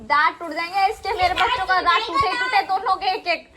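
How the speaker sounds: warning. Real, not acted. Mother warning her skating kids. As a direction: warn them they'll break their teeth.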